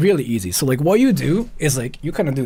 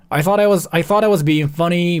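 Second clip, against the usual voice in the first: usual speaking voice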